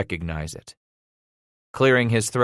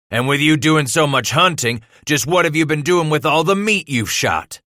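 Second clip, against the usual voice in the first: loud, raised angry interrogation; thundering; 7 semitones higher, 5 dB louder than usual. A narrator's reading. Father grilling son over hunted meat, thundering. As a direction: drive the question at him, building. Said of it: "he thundered".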